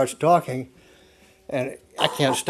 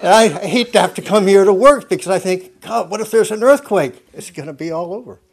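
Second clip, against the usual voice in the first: higher, louder retelling of someone's worry; retold worried exclamation; 7 semitones higher, 9 dB louder than usual. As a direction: voice a worker's mock alarm, then let it drop. Quoting the anxious garage worker.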